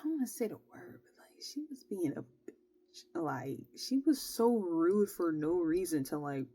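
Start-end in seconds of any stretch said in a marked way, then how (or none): 0.5-3.0 breathy secret whisper on a slower line; whispered aside. Real, not acted. Whispering to viewers a word she avoids saying. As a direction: whisper it like gossip you shouldn't say.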